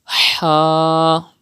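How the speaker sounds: "Ha" squeezed from the middle of the throat; long "Ha" with Arabic throat friction. Shown as a demonstration. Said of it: "pronounce ha from the middle part of your throat".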